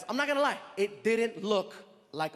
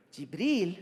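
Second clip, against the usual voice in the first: puzzled echo. Retold or imitated. Comic echoes the prince, puzzled.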